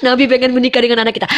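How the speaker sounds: giggling through the words; laughing. Real, not acted. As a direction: laugh while telling your husband. Calls husband, laughing. (berbicara sambil tertawa)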